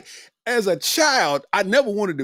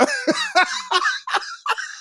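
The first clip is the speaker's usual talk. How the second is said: shrill burst of laughter; high wheezing laugh fit; high squealing laugh. Real, not acted. Cracking up at own joke.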